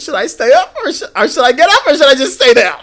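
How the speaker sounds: loud, high mock-distressed dithering; hammed-up shouted indecision; retold panic. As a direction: agonise comically at high pitch. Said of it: "I was on the ground".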